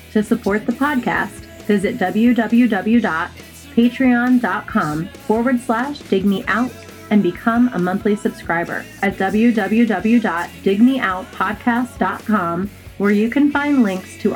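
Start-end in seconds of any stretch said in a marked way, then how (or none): none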